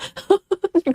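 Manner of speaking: high-pitched giggling burst; high giggle. Real, not acted. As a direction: giggle helplessly. Laughing fit.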